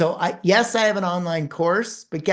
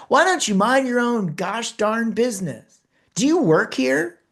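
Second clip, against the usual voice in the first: imitating a furious mother, pitched up; mock rage at a meddling stranger; angry confrontation; 6 semitones higher than usual. Angry mother snapping at the speaker in line.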